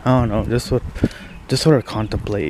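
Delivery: ordinary talk, nothing marked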